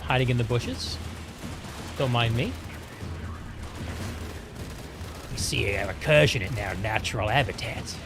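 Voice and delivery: hushed Aussie nature-host voice, lightly done; Steve Irwin impression. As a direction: whisper like a wildlife presenter watching prey. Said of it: "sorry for the terrible Steve Irwin impression".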